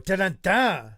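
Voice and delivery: sung-out "dun dun dun"; hammed-up soap opera sting; mock dramatic sting. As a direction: voice a suspense sting as a joke. Mock dramatic sting after grim remark.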